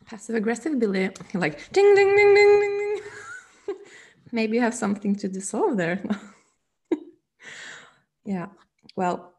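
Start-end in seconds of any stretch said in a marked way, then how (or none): 1.3-3.8 high, loud, sing-song bell dings, breaking into a laugh; mock bell dings. Teasing the guest about a passive aggressive bell, loud high dings.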